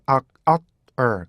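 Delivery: "악, 악, er" as three clear beats. Shown as a demonstration. Teacher splitting "are" into three beats. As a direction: break "are" into three parts. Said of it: "두 번째 음절".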